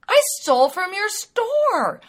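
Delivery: shocked realization. Retold or imitated. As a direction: exclaim it as the truth dawns.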